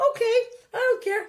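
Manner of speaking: put-on very high, quick child's brush-off; dismissive kid. Child answering a parent about picked-up clothes, high and dismissive. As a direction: put on a little kid who shrugs it off.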